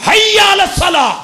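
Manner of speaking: حی علی الصلاح shouted high and loud as an order; commanding shout. Shown as a demonstration. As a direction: bark حی علی الصلاح like a stern summons. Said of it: "لب و لہجہ پر رکھنا".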